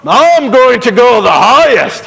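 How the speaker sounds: loud, swaggering brag; boastful eagle. A narrator's reading. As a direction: strut while you say it. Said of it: "he would say".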